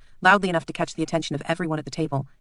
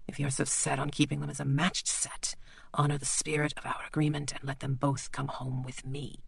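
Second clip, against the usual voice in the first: quiet menacing doctor; 8 dB quieter than usual. Acted. Bleak quietly reminds the lord of their deal.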